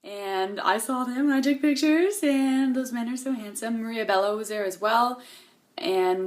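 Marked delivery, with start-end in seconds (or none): none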